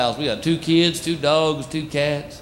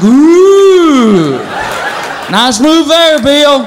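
higher, louder mocking dog voice; teasing dog; 7 semitones higher, 4 dB louder, slower than usual. Dog watching the couple in bed, higher, loud and teasing. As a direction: heckle the lovers like a wisecracking dog. Dogs commenting on sex.

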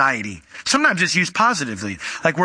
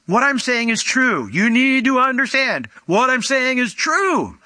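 high-pitched, insistent declaration; emphatic insistence; 8 semitones higher than usual. Acted. Preacher explaining Jesus' doubled words, with emphatic insistence. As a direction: press each phrase home, pitched well up. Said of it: "it's for emphasis in the culture".

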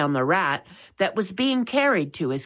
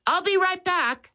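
put-on higher boyish voice for Max; a young boy reminding his brother; Max's voice; 13 semitones higher than usual. A narrator's reading. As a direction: read Max a good bit higher, earnest. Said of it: "he told Molly and Mitch".

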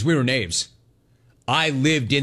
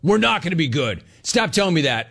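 ordinary talk, nothing marked

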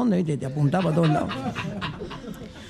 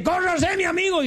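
higher, brisk and bossy; the gaucho returning with his jug, brisk and higher; pushy gaucho; 11 semitones higher than usual. Acted. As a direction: shove past with a firm word. Gaucho pushes past.

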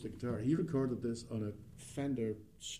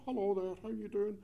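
cheery Scottish hello; 10 semitones higher than usual. Retold or imitated. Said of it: "in my lovely Scottish accent".

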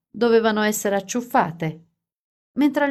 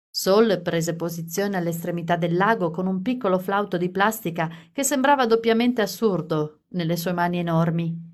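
plain reading voice

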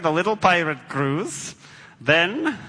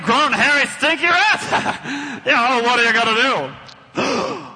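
normal talking voice

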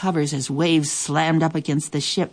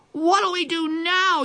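much higher, slow cry; pirate yelling; 12 semitones higher, slower than usual. A narrator's reading. A pirate yells to the others after lightning hits. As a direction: cry it out in panic. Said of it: "yelled one of the pirates".

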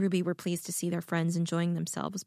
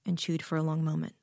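usual speaking voice

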